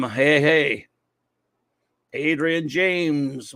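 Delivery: ordinary talk, nothing marked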